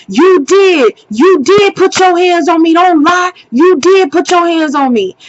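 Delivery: high, heated insistence; insistent accusation. Retold or imitated. Girlfriend behind the camera contradicting his denial, heated accusation. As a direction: accuse him to his face, stressing DID.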